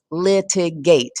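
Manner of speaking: litigate slowed into three beats, lit-i-gate; three separate syllables. Shown as a demonstration. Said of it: "has three syllables".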